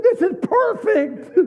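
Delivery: high, slow, laughing exclamation; laughing delight. Retold or imitated. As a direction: pastor delighted at the couple's mismatch. Pastor delighted with couple.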